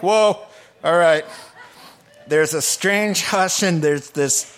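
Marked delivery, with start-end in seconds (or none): 0.0-1.2 loud startled whoa with a laugh; mock the bearers jumping back. Preacher imitating freaked-out bearers, loud startled whoa. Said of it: "So they're like".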